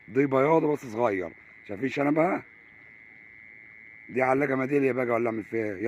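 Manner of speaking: loud, high and thrilled over the fish; excited. Real, not acted. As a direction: show off the catch with excitement. Excited over a small catch. (حماس وصوت عالٍ)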